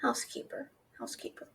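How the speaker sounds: a soft whispered repeat under her breath; whispered to herself. Real, not acted. Muttering the dragon's odd answer back to herself, whispered. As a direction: breathe the word, barely voiced, mulling it over.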